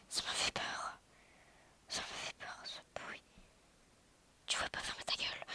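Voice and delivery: hushed unvoiced whisper. Real, not acted. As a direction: whisper it under breath. Whispering while recording.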